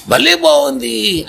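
high, slow, thrilled cry of joy; delighted exclaim. Acted. Storyteller voicing the happy tortoise, high and slow with delight. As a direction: let the joy burst out, drawn slow. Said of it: "అందామని నోరు తెరిచిందిట".

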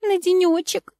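high, begging little voice; pleading. A narrator's reading. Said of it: "взмолилась девчонка".

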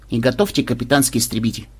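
ordinary delivery

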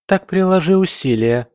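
high-pitched burst of temper; flaring up. A narrator's reading. Camilla tells her sister to make an effort, flaring up. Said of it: "вспылила Камилла".

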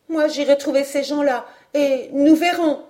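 put-on high, loud, defiant young voice; boyish threat. A narrator's reading. Young Robert vowing to confront those men, threatening. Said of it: "Robert n'acheva pas sa menace".

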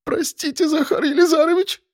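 put-on very high, thin frightened girl; high, strained young woman's plea; squeezed-out plea. A narrator's reading. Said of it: "смогла лишь выдавить девушка".

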